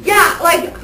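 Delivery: usual speaking voice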